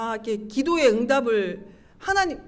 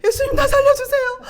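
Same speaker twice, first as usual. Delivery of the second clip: very high, loud acted plea; emotional outcry; 13 semitones higher, 9 dB louder than usual. Wife begging Jesus to rescue her, desperate acted outcry. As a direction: cry out desperately at the top of the range. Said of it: "막 감정이 오르락내리락 막 하는데".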